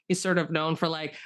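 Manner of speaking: normal talking voice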